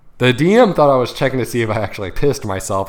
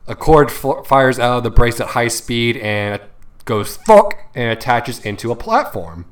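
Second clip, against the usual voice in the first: usual speaking voice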